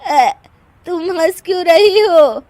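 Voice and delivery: loud, high wail; wounded protest. Acted. Widow protests at laughing visitor.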